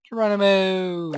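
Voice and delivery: high, loud drawn-out cry; calling out. Real, not acted. Player leaps off shouting Geronimo, long loud call. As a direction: yell it like a leap off a ledge.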